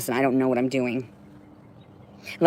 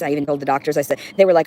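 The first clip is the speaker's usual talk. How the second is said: usual speaking voice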